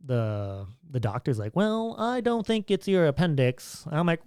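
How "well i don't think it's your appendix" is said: lightly voiced doctor, pitched higher; retold doctor. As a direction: imitate a doctor with a high-pitched voice. Retold doctor's line, acted.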